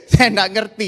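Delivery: laughing. Real, not acted. Laughing while recalling.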